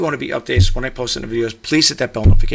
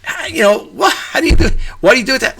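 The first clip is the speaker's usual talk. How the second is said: much higher-pitched mock questioning; imitating puzzled askers; 9 semitones higher than usual. Seller quoting people who question his offers, mock puzzlement. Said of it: "people are saying".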